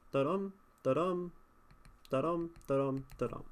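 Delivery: five "Da-dum" beats, each "dum" stressed; iambic pentameter. Shown as a demonstration. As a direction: count out five weak-strong "da-dum" feet. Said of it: "Five I ams".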